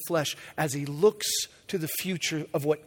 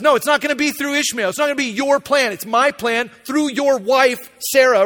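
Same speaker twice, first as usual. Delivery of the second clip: loud, higher, final, God putting His foot down; emphatic loud declaration; 7 semitones higher, 9 dB louder than usual. Acted. God firmly refusing Abraham's Ishmael plan, loud and emphatic. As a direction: declare it with full divine authority.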